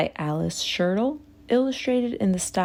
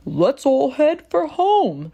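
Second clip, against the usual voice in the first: higher, louder cow voice; 6 semitones higher, 5 dB louder than usual. A narrator's reading. Cow urges the group home, loud voice. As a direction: cow calls everyone home. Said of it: "said Cow".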